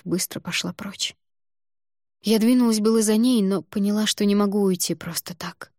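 usual speaking voice

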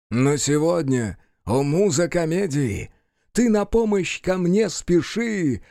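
invocation of the muse, rising in pitch; theatrical verse recitation. Acted. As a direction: declaim it with a climbing, pleading call. Actor recites verse to the king.

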